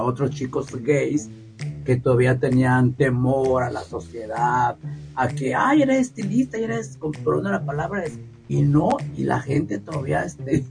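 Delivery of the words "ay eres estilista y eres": higher-pitched mimicked gossip; mock gossiping onlooker. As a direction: lightly imitate society's remarks in a high voice.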